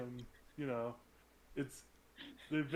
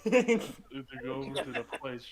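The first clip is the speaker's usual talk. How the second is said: loud, slow, deliberate answer; loud slow reply; 10 dB louder, slower than usual. Acted. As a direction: call it out slowly.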